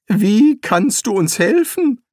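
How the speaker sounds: Rincewind asks, clearly baffled; rising, puzzled question; dumbfounded wizard asking back. Acted. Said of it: "fragte er verblüfft".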